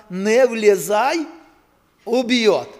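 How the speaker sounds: slow, loud sign reading; reading a warning sign. Retold or imitated. Preacher reading the pole sign to the congregation, slow and loud. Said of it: "і так написано".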